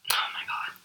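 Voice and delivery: breathless whispered aside, no voice at all. Real, not acted. Talking to the bullies who will hear this, a whisper. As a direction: let it slip out as a disgusted whisper.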